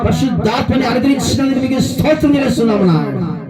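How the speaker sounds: high, intoned prayer rising in pitch; fervent prayer. Real, not acted. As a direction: pray aloud in a sustained, climbing chant. (ఆవేశంతో హెచ్చు స్వరంలో ప్రార్థన)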